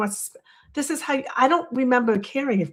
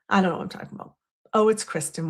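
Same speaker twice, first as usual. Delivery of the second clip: ordinary talk, nothing marked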